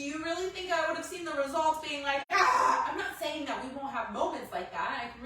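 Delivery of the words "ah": louder, higher half-laughing shriek; mock panicked scream. Imagining her panic at the results, mock shriek.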